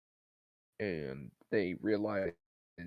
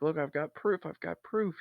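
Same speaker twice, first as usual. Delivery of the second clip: higher-pitched, retold insistence; insistent protest; 6 semitones higher than usual. Astronaut insisting to his buddy he has proof, higher-pitched mock insistence. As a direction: insist on it in a raised pitch, lightly acted.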